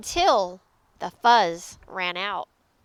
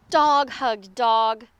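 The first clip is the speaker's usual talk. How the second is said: usual speaking voice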